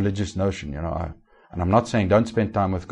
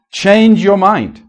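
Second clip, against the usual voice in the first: much higher, louder, slow exhortation; preaching shout; 10 semitones higher, 9 dB louder, much slower than usual. Real, not acted. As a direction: hammer it out slowly, loud.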